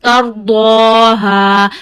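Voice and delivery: Tardaha chanted slowly, the long a held; slow measured tajweed chant; stretched dad. Shown as a demonstration. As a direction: draw out the Tardaha vowel like Quran recitation. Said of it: "stretch dad ... up to one finger".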